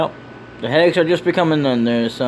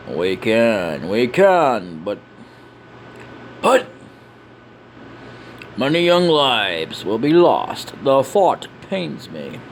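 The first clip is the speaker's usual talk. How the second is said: solemn leader; 2 semitones lower, much slower than usual. Acted. Leader mourns coming losses.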